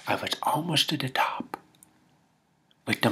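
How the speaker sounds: hushed whisper of suspense. Acted. Storyteller reaching the tense moment, hushed whisper. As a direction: drop to a whisper at the scary part.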